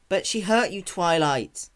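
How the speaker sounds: near tears. Acted. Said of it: "on the verge of tears".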